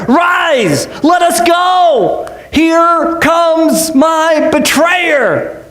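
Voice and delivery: slow it down and proclaim it; urgent master rousing his sleepy followers; Jesus urging. Acted. Jesus waking disciples, betrayer approaching.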